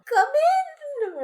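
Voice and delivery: very high, loud, hammed-up voice; a silly strained old man voice; squeaky mock old man. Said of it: "what's an old man voice".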